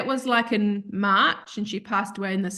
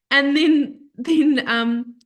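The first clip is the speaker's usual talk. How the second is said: ordinary talk, nothing marked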